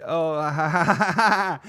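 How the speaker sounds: imitated giggling at videos; rising put-on chuckle; acted laughing along. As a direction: laugh as if scrolling funny clips. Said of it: "I was like".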